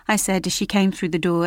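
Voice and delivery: ordinary delivery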